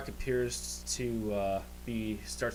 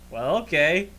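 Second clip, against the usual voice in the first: higher, louder pleased barkeep voice; smiling tavern keeper; 6 semitones higher, 10 dB louder than usual. Acted. Tavern keeper accepts a kiss for ale, smiling. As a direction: agree with a smile, pitch swooping up. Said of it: "He smiles and goes".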